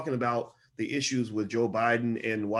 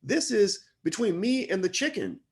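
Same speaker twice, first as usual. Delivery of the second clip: high, heated protest in a raised pitch; exasperated outburst; 5 semitones higher than usual. Retold or imitated. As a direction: pitch it up and push back, fed up. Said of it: "And I'm like".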